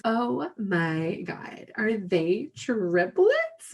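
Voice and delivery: very slow, drawn-out astonishment; gawking stranger. Retold or imitated. Older woman at the store marvels at triplets, slow gushing. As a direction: stretch each word like an amazed onlooker.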